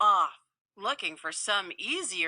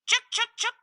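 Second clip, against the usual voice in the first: high chattering squirrel scold; sharp high squirrel chuks; 14 semitones higher, 3 dB louder, faster than usual. Acted. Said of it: "She even scolded the man".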